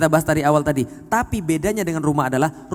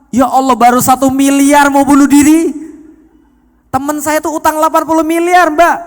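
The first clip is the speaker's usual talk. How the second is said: loud, high mock-incredulous outburst; astonished exclamation; 8 semitones higher, 9 dB louder than usual. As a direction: exclaim in disbelief at full volume. Acted astonished reply to woman's debt.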